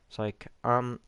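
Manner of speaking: ordinary talk, nothing marked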